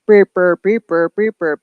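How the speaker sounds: quick bouncy "burp" noises; playful imitated noise. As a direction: rattle off silly repeated sounds.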